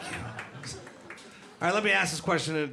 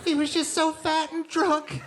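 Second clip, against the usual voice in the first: fake innocent wife; 11 semitones higher than usual. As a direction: play the sweet, blameless widow. Wife fakes innocence to cops.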